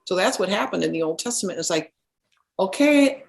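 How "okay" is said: tentative okay. Retold or imitated. Acting out reaction to learning ascension.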